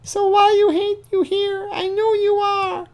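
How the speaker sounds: put-on high falsetto whiny girl, second line slowed; mock girl voice. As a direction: squeak it out like a clingy teen girl. Mocking girl's chat messages.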